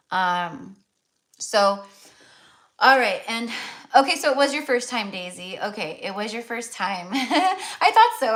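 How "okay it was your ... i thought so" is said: laughing. Real, not acted. To Daisy, laughing that she guessed right. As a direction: laugh through the words, amused.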